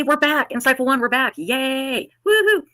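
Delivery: high, loud happy cheering; excited cheer. Real, not acted. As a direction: welcome everyone back with a whoop. Host cheering return to show.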